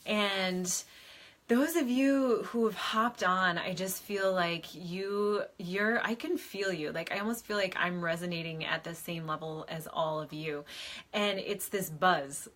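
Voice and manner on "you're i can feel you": ordinary delivery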